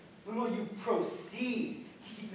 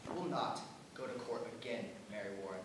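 much lower, softer, menacing order; cold command; 8 semitones lower, 8 dB quieter, faster than usual. Acted. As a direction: say it low and final. Master lays down the law.

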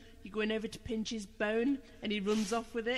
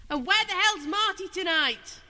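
loud, slow demand; 9 semitones higher, 8 dB louder than usual. Real, not acted. Demanding where Marty is.

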